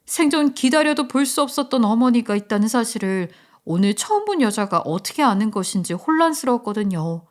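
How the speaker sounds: ordinary delivery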